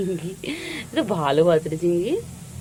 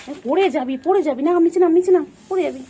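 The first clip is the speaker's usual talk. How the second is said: alarmed warning; 8 semitones higher, 6 dB louder than usual. Real, not acted. Warning pet about falling.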